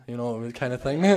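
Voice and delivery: normal talking voice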